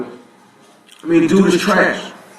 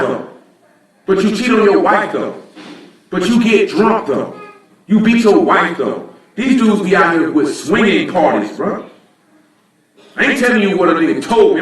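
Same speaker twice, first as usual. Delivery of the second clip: normal talking voice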